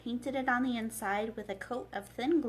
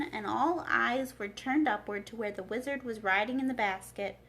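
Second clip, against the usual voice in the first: plain reading voice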